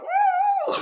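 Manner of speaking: a giddy high woo; party whoop. Retold or imitated. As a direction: cheer like you're partying.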